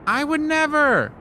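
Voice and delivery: high, outraged mock denial; high fake innocence. A narrator's reading. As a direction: squeal a hammed-up denial. Anansi feigns innocence.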